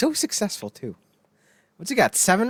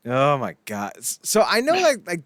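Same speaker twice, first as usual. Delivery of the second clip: usual speaking voice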